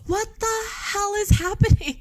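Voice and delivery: high, stunned disbelief; incredulous. Retold or imitated. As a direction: squeal it out, baffled. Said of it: "And I was like".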